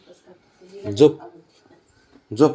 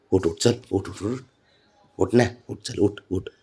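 softer gentle wake-up murmur; quiet repeated get up; soft coaxing; 5 semitones lower, 8 dB quieter than usual. Real, not acted. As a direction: coax him awake softly. Gently waking sleepy pet.

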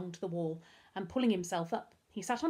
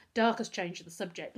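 ordinary delivery

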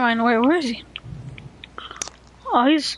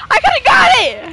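excited; 10 semitones higher, 5 dB louder than usual. Real, not acted. Missed rocket launcher.

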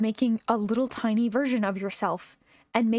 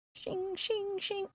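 higher, softer mock blade sounds; playful whispered scythe swishes; 10 semitones higher, 8 dB quieter, much slower than usual. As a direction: hiss the scythe strokes up high. Playing Grim Reaper with scythe.

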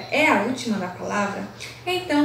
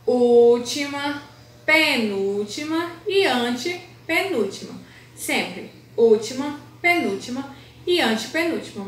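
emphatic listing. Shown as a demonstration. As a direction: stretch each term for a class to memorise. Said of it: "Você sempre vai olhar como". (enumeração lenta e bem marcada)

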